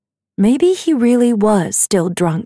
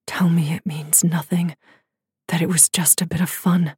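hushed inner voice, fast at the end; whispered thought; 3 semitones lower, 6 dB quieter than usual. A narrator's reading. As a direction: breathe it as a private thought. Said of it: "he thought".

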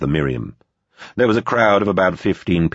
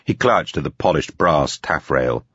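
ordinary delivery

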